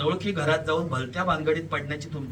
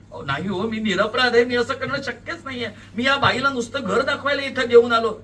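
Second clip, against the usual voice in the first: high, loud pleading; desperate protest; 9 semitones higher, 9 dB louder than usual. A narrator's reading. Accused man to police and crowd, protesting desperately. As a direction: plead innocence over the accusers.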